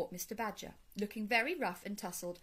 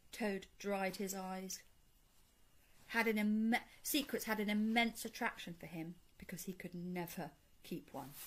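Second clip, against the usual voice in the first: ordinary delivery, nothing marked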